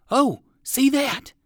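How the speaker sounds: put-on high, slow, wide-eyed boy; a boy pointing in alarm; boy spots a tiger in the bushes, crying out high; startled cry. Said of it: "cried one boy".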